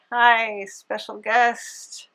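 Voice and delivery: normal talking voice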